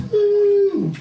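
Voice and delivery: high loud whoop. Acted. Comedian cheering America to the crowd, loud whoop. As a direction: let out a big cheer.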